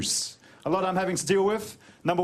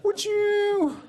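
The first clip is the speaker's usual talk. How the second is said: high pleading voice; 8 semitones higher, slower than usual. Retold or imitated. His reply to the gang.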